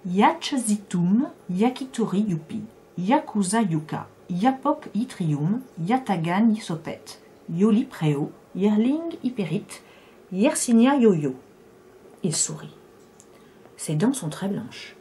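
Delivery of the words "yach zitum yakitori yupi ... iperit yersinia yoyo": slow, rhythmic recitation; incantatory word list. Acted. The man reciting Y words to the woman, slow chant. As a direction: chant the Y words like a spell, unhurried.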